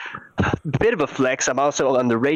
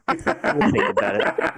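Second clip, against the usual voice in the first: laughing mock; 4 semitones higher, slower than usual. Laughing through quote.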